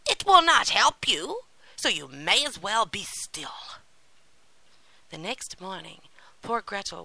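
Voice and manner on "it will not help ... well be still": put-on angry old witch. A narrator's reading. Witch scolds the weeping Gretel, angry. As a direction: scold her as a cross witch. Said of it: "cried the old witch angrily".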